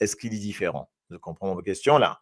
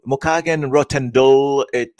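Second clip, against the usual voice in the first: mock English-mixed Creole; 5 semitones higher, 8 dB louder, slower than usual. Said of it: "je vais pas dire ça comme ça en anglais".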